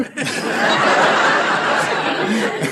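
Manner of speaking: long airy laugh; unvoiced chuckle; breathy laugh. Real, not acted. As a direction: laugh at the comeback. Groucho laughs at reversed reply.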